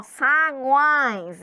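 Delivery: SAGUÕES with a long nasal ÕE; nasal sound. Shown as a demonstration. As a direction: let "Saguões" come out through the nose. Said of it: "sai pelo nariz, é som nasal". ("Saguões" alongado, som pelo nariz)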